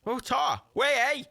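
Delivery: high-pitched, hammed-up Geordie exclamation; Newcastle accent. Gamer reading out a character's name, mock Newcastle accent. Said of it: "Oh, fucking Newcastle".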